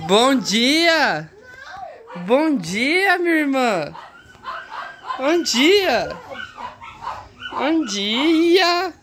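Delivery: sing-song wake-up call. Real, not acted. Brother calling out to wake his sleeping sister, sing-song. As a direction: ring out a cheery wake-up greeting. Wakes sister with morning greeting.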